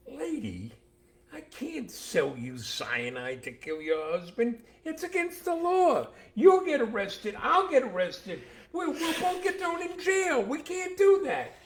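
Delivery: panicked pharmacist. Acted. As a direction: squeak it out like a panicking shopkeeper. Flustered pharmacist voiced in joke.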